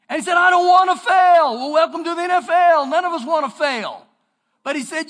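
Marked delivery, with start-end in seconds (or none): 0.4-1.6 loud, desperate plea; anxious outcry. Acted. Joshua crying out to God, loud and desperate. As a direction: cry out that you can't fail.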